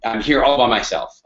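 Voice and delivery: ordinary delivery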